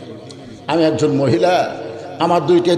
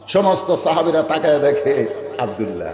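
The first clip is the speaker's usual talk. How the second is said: normal talking voice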